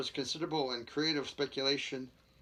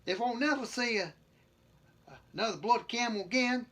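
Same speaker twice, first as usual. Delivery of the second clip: hammed-up British accent, much higher; 8 semitones higher, 4 dB louder than usual. Shown as a demonstration. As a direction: put on a shaky attempt at British, pitched up. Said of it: "say it in the British accent".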